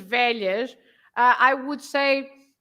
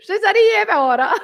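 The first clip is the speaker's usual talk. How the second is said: put-on louder, higher laughing Italian accent; mock Italian, laughing; 7 semitones higher, 8 dB louder than usual. Co-host joins the joke, laughing Italian accent. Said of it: "Like that".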